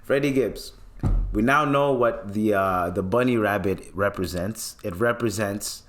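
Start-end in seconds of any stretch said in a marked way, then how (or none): none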